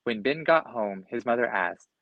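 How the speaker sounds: plain reading voice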